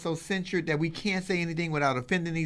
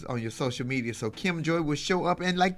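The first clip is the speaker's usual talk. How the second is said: ordinary talk, nothing marked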